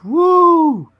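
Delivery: high, loud cheering "woo"; excited whoop. Real, not acted. Fan cheering the character designs, excited whoop. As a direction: let out a real whoop of delight.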